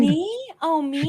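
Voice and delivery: high, coy mock surprise; playful surprise. Real, not acted. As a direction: act flattered and caught off guard. Co-host greeted by host.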